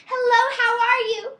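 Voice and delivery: a big high shouted hello; loud yell. Shown as a demonstration. Showing a child the wrong way to greet, loud high yell. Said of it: "yell across the room and say".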